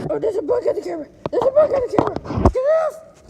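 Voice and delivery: high loud yelp; startled shout. Real, not acted. Kid shouting in alarm at a bug on the camera. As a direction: yell at the bug to get off.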